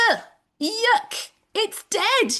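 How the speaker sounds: high, scrunched-up "ugh, yuck" of mock disgust; disgusted groan. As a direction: pull a face at dead grass, voice rising. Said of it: "I look at that and think".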